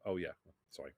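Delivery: ordinary delivery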